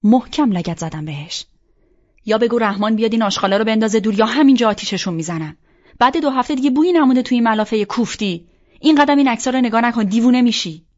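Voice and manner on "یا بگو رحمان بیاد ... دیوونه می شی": loud, rapid scolding, rising higher; angry outburst. A narrator's reading. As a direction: rail at her in exasperation, fast and loud. Furious ultimatum over the sheets. (پرخاش تند، بلند و عصبانی)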